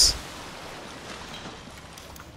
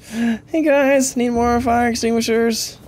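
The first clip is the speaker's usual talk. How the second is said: higher, sing-out call to the crew; playful calling out; 5 semitones higher than usual. Pretend. Gamer hailing imaginary helpers for supplies, playful call. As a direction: call out jokingly for help. Mock call out for more extinguishers.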